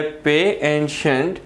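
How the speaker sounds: पे and shunt as two slow, separate parts; slow teacherly split of पे and shunt; syllables named separately. Shown as a demonstration. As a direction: set पे apart from shunt. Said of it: "यानी टू syllable word".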